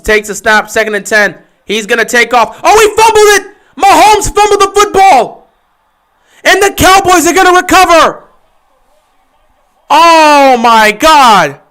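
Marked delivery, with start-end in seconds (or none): 2.6-8.2 high, loud play-by-play burst; excited call. Real, not acted. Streamer calling the fumble live to chat, excited. 9.9-11.6 loud shocked gasp of a cry; stunned outburst. Real, not acted. Reacting to the fumble.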